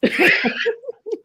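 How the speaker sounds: bright burst of laughter; laughing. Real, not acted. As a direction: break into a laugh. Laughs after teasing.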